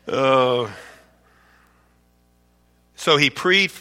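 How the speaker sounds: ordinary talk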